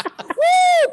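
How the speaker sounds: high, loud "woo"; gleeful whoop. Real, not acted. Crowing about all the sad dudes, gleeful high whoop.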